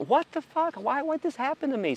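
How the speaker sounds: very high, exasperated cry; stuck on the roof, exasperated and high; stranded disbelief. Retold or imitated. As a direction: squeal at the bad luck. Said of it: "It's like".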